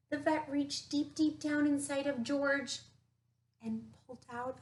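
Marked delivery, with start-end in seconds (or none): none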